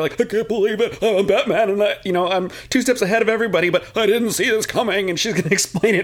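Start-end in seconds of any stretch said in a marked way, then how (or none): 0.2-5.1 lightly voiced stunned Batman; mock Batman. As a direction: play a dumbfounded hero, half-joking. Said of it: "he's just like, staring at her".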